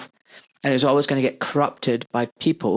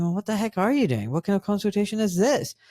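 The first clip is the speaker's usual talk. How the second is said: higher, puzzled listener voice; imagined skeptical listener; 7 semitones higher than usual. Pretend. Host voicing a listener who doubts his service, mock puzzled. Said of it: "some of you might be thinking".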